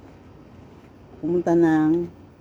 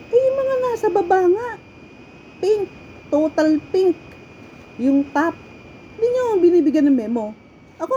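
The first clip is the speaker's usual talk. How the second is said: ranting at unfair managers, voice raised high; indignant rant; 7 semitones higher than usual. Retold or imitated. To the managers who wrote her up, an indignant high rant. Venting about unfair memo.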